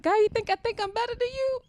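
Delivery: high, loud astonished question to God; shocked disbelief. Retold or imitated. Retelling her shock to God, high and loud disbelief. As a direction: recoil in disbelief at the accusation.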